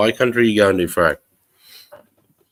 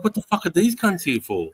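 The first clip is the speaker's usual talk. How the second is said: higher, startled inner voice; suddenly alarmed, higher up; retold alarm; 9 semitones higher than usual. Retold alarm at cops arriving.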